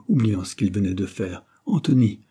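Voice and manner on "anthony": put-on higher and more pressing, old man voice; an old uncle growing agitated; insistent dying man.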